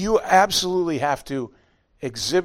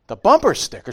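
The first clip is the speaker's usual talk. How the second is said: surprised; 7 semitones higher, 7 dB louder than usual. Retold or imitated. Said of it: "And I'm like".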